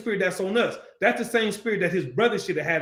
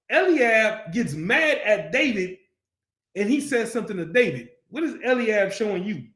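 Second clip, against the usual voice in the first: normal talking voice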